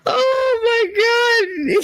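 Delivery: high, very slow laugh-cry; crying laughter. Real, not acted. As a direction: drag it out through tears of laughter. Helpless laughter at own story.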